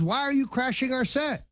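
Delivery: high, shrill-pitched mock scream; retold screaming. Woman in the red dress yelling at guests, high mock scream. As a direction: shriek the question in high mockery. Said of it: "screaming at the top of her lungs".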